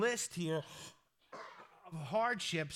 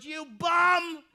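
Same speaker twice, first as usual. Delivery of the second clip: heckler's yell; 8 semitones higher, 13 dB louder than usual. Retold or imitated. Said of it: "the guy in the bleachers ... goes".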